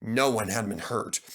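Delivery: ordinary delivery, nothing marked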